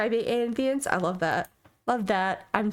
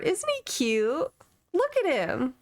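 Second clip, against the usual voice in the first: cutesy cooing; 7 semitones higher, slower than usual. Real, not acted. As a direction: coo over him like a puppy. Cooing over a cute picture.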